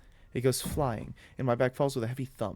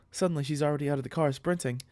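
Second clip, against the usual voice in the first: ordinary delivery, nothing marked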